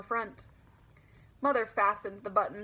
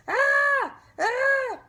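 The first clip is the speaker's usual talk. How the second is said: much higher, much louder shrieking ah; scariest scream; 8 dB louder than usual. Acted. Girl jumping out at grandma, full scream. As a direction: scream to scare grandma with all your might. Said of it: "I kept on screaming".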